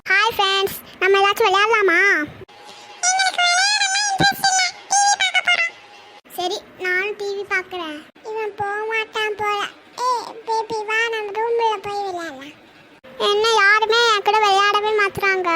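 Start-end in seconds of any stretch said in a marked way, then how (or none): 3.1-5.7 put-on bratty high child brushing someone off; high child voice turning down a playmate; high kid voice. Kids brush off cousin. 6.4-12.5 softer, conspiratorial under-the-breath talk; softer hushed plotting between kids; hushed aside. Acted. Kids sneak away from cousin.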